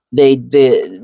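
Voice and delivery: ordinary talk, nothing marked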